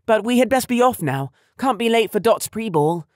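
put-on high, loud hurried girl; urgent teen girl. A narrator's reading. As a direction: hurry her dad along. Girl urges father to let them leave.

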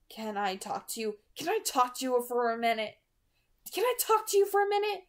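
put-on rising, urgent girl's hiss; hissed request. A narrator's reading. Bella cornering Edward, hissing her demand. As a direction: press her in a tight hiss. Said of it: "she hissed at me".

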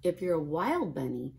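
usual speaking voice

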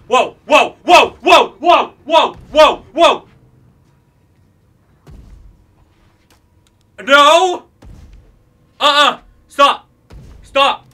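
panicked yelling, high and loud; scared horror game streamer. Real, not acted. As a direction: shout in real panic at a jumpscare.

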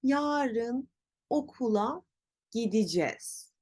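gideceğiz as gi-di-jez; spoken contraction. Shown as a demonstration. Teacher leads repetition, gideceğiz as gidicez. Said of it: "Tekrar söyleyelim".

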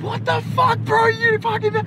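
high squeaky retold shock; stunned disbelief. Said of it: "he said".

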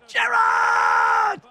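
long drawn-out yell of the name; playground scream. Retold or imitated. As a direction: shout it like a kid mid-kick. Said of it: "what are kids nowadays screaming?".

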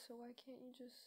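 unvoiced trailing whisper. Real, not acted. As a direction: fade into a whisper.

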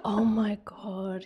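hushed, breathy oh my god; shocked whisper. Retold or imitated. Remembering the cousin's message, a shocked whisper. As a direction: whisper it in dismay. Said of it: "And I was like".

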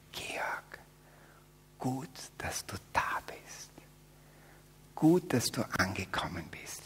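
soft, slow, tender whisper; Jesus whispering welcome. Retold or imitated. Jesus greets Georg at the end, softly whispered. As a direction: welcome him home in a whisper. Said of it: "wird sagen".

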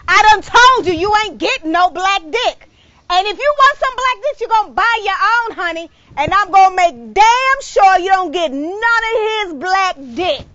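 loud, high, furious tirade; wife yelling at the friend, loud and high; jealous yell. Acted. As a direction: warn her off your husband.

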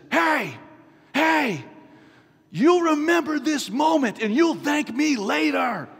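high, loud shout; scream. Retold or imitated. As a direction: yell it at your wife across the aisle. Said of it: "And I screamed at her".

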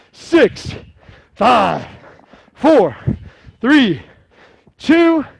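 high, loud coach count; shouting countdown. Real, not acted. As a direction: yell it to the finish.

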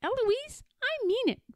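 very high, squeaky parroting; mock shout. A narrator's reading. Eloise right after her tutor, high mock shout. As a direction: echo it high without volume. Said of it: "And then I shout".

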